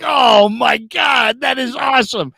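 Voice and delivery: high, loud, slowly stretched amazement; ecstatic reaction. Retold or imitated. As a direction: imitate someone thrilled, drawing out every word. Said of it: "And he's like".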